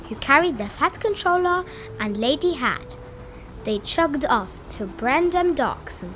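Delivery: ordinary delivery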